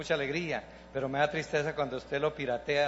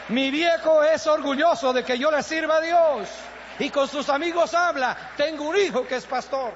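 louder, higher preaching build; fervent preaching; 10 semitones higher, 8 dB louder than usual. Acted. Pastor proudly tells the congregation about his father. Preacher rising to full force.

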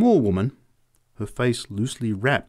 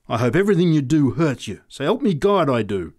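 louder, higher, savage outburst; savage curse; 4 semitones higher, 6 dB louder than usual. A narrator's reading. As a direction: curse him with real venom. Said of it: "she said savagely".

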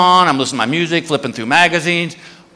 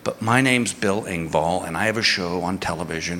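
low, hushed, halting confession; sheepish plea; 9 semitones lower, 8 dB quieter than usual. Retold or imitated. Comic retells his awkward plea to the TSA guy, sheepish and hushed. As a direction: keep it low and discreet, almost embarrassed.

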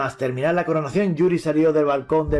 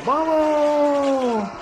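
rallying cry; 10 semitones higher than usual. Acted. Riders cheer charging.

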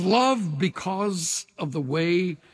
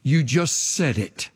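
the enemy's voice; 9 semitones lower, slower than usual. Retold or imitated. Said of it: "and the enemy says".

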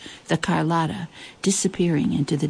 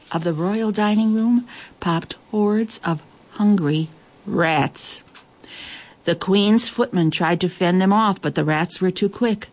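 ordinary delivery, nothing marked

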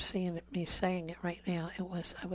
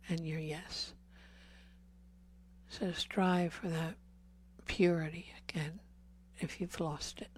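intimate preaching. Acted. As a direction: preach close to the congregation, dropping to a breath. Sermon on purity, soft intense preaching.

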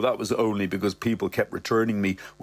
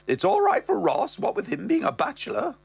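mother's voice; 12 semitones higher than usual. Acted. Mother talking to Brett, higher voice. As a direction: lift up as the mother. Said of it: "She goes".

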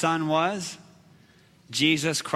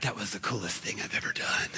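hushed, awed whisper to himself; 9 semitones lower, 10 dB quieter than usual. Retold or imitated. Boy thinking to himself after releasing the shark, whispered. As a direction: breathe it like a secret wonder. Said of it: "And I thought".

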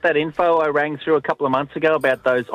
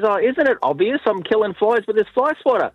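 higher-pitched, mock-obvious explanation; lightly acted husband stating the obvious; defensive retort; 7 semitones higher than usual. Husband explains himself to wife, mock defensive.

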